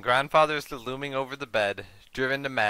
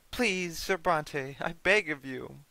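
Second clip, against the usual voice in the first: pleading mother; 4 semitones higher, slower than usual. Acted. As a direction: beg him for your life. Mother pleads for her life.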